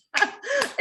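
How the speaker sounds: sudden high-pitched laugh; high burst of laughter. Real, not acted. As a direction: break into a real laugh. Teacher laughs at student attempt.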